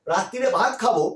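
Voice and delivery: ordinary delivery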